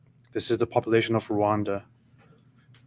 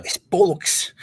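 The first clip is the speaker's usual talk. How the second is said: put-on higher posh British accent; mock British accent; 8 semitones higher, 5 dB louder, slower than usual. Mocking the smart British accent for a cohost. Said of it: "say absolutely anything with a smart British accent".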